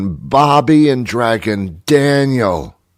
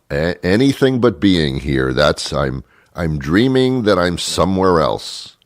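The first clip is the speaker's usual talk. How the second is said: ordinary delivery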